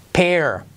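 "pear" whispered without voice; "pear" whispered, falling; whispered drill word. Shown as a demonstration. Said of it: "Otra vez, par".

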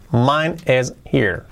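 slow, separated words; careful teaching repeat. Shown as a demonstration. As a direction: space the words out for learners. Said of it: "Dicho rápido".

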